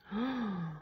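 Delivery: sharp gasp. Real, not acted. Shocked by the card drawn.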